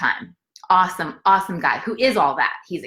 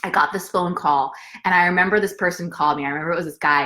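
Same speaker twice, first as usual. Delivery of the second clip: usual speaking voice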